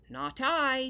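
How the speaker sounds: loud, high refusal; flatly refuse, loud and high; firm declaration. Acted. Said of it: "declared young Tom".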